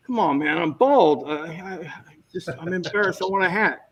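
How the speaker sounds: mildly whiny retold plea; pleading protest. Bald actor asking the director for a hat, mild mock plea. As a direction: plead half-jokingly with the director.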